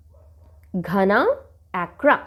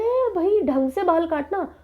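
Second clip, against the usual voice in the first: high, demanding call to the barber; bossy customer; 7 semitones higher than usual. Acted. Customer ordering the barber to cut properly, high and bossy. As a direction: order him around, pitched up.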